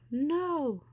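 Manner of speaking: scream. A narrator's reading. Ariel screaming as the monster reaches for her. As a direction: scream it in terror. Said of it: "screamed Ariel".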